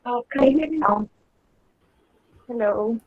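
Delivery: ordinary talk, nothing marked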